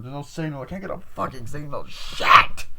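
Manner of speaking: high complaint bursting into a loud curse; exasperated outburst. Retold or imitated. Mimicking the film's no-signal complaint, exasperated outburst. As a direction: build from mock whining to a shouted curse.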